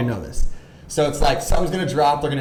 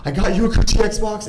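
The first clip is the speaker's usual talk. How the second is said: mock breathless, higher excited voice; breathless excited caller; 8 semitones higher than usual. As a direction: play her rushed and out of breath. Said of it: "she's, like, breathing heavy".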